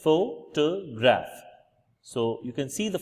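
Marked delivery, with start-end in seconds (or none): 0.0-1.3 pho-to-graph, slowly broken apart; photograph in three syllables. Shown as a demonstration. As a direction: separate each syllable of photograph. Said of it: "there are three syllables here".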